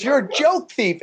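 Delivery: slow, high-pitched imitated accusation; accusing heckler. Heckler shaming a comic before his family, mock high accusation. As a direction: draw out the charge as a mock heckler. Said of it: "in front of everybody".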